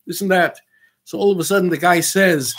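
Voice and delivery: usual speaking voice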